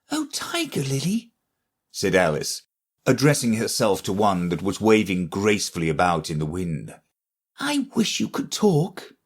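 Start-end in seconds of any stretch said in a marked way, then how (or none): 0.1-1.3 put-on high, light girl's voice; young Alice. Alice voiced in a read story. 7.6-9.1 put-on high, light girl's voice; young Alice. Alice voiced in a read story.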